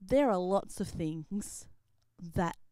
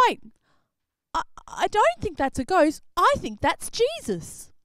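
Peter realising; 11 semitones higher, 10 dB louder than usual. Acted. Peter recognises Jesus.

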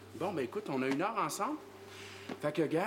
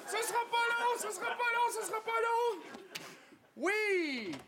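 high, panicky repeated cries; frantic crying out; 11 semitones higher than usual. Acted. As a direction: keep crying out while stalling someone. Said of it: "J'ai entendu crier". (cris aigus et paniqués)

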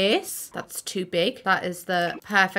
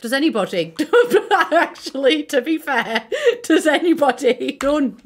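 giggly, laughing delivery; 5 semitones higher, 5 dB louder than usual. Real, not acted. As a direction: laugh through the question. Laughs at own joke.

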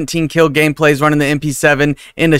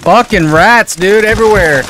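frustrated yell; 6 semitones higher, 4 dB louder than usual. Real, not acted. Ambushed by teams everywhere.

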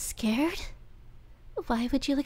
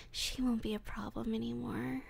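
soft sinister whisper; menacing whisper; 9 dB quieter, faster than usual. Acted. Captor whispers threat about the girlfriend, sinister hush. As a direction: let the threat slip out hushed.

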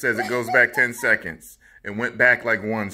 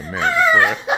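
laughing; 11 dB louder than usual. Real, not acted. Laughing fit during recording.

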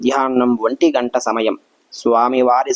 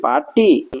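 second call jumps much higher, falling at the end; 10 semitones higher than usual. A narrator's reading. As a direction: call party again, higher and more urgent. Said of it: "ఇలా గట్టిగా అరుస్తున్నందుకు".

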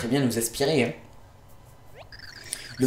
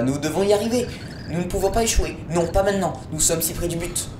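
higher, earnest resolve; lightly voiced determined hero; 7 semitones higher than usual. Gamer reading a hero's determined line to the team. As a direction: urge the team not to fail.

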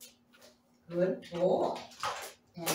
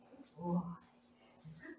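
ordinary talk

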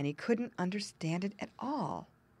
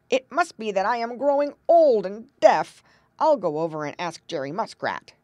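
louder, put-on old frog voice, fretting about going deaf; old frog worried; 3 semitones higher, 10 dB louder than usual. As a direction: play an anxious old frog who thinks he's deaf. Narrator voices Grandfather Frog.

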